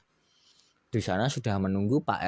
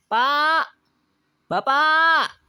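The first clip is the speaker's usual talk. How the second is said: high, loud wife calling from afar; Bu Juli calling out; 8 dB louder, much slower than usual. Acted. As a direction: call out to her husband across the field. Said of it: "kata Bu Julinya". (istri memanggil suami dari jauh, suara tinggi dan keras)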